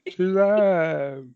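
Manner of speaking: high, loud theatrical ghostly cry; Shakespearean ghost. Acted. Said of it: "trying to sound dramatic, like Shakespearean".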